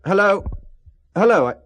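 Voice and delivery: shouting hello into the phone after the caller hangs up; calling into a dead line. Acted. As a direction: call after him as the line cuts.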